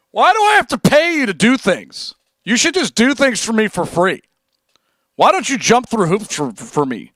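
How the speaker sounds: high, whiny mock of an entitled fan; mock the demanding viewers in a put-on voice; huffy entitled whine. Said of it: "who come in here all huffy".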